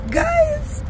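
high strained squeak, barely any voice; squeaky lost voice. Real, not acted. Mom greeting viewers with a voiceless squeak. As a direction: push the word out through a dead throat. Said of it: "I lost my voice".

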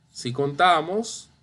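ordinary delivery

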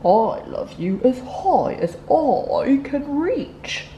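slow, tender big-hare voice; slow and loving, outdoing the little one; lightly voiced fond father; gentle parent hare. A narrator's reading.

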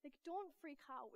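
soft, careful retold plea; imitating someone tiptoeing into bad news; cautious breaking of news. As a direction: tread gingerly, voice held down. Ben nervously breaks bad news to Esther.

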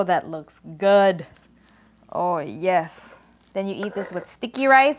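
ordinary talk, nothing marked

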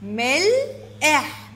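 ملح slowly split as مل، إح; syllable by syllable. Shown as a demonstration. Teacher giving the breakdown of ملح, syllable by syllable. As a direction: stretch and separate both parts of ملح. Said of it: "تقطيعها".

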